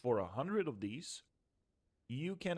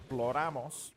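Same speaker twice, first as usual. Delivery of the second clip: "Floramos" with a Spanish accent and a rolled r; 4 dB louder than usual. Shown as a demonstration. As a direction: say "Floramos" like a proud Spanish speaker, a bit louder. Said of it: "let me say this a little bit in a Spanish accent".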